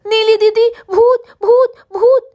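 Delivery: high, loud panicked cry of भूत; frightened child shouting ghost; scared shout. Acted. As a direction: yell for didi in terror. Girl runs screaming about a ghost.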